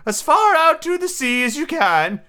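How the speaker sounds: high-pitched, earnest storybook turtle; mock turtle. Mock turtle telling Alice about sea life, earnestly.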